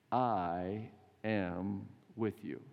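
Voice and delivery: very slow, drawn-out words of comfort; unhurried, solemn voice of Jesus promising presence; slow solemn assurance. Retold or imitated. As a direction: linger on every word like a promise.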